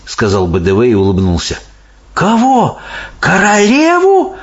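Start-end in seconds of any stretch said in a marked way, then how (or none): 2.1-4.4 put-on high, horrified girl voice; gasping disbelief, pitched way up; horrified exclamation. A narrator's reading. Sophie learns the Queen may be eaten, horrified. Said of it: "в ужасе воскликнула Софи".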